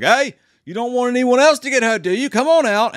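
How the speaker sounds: high, loud menacing call from outside; Mick Taylor. Retold or imitated. Killer calling the hiding man outside, high loud menace. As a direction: taunt them from outside the house. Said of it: "Mick Taylor's voice".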